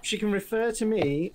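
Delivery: usual speaking voice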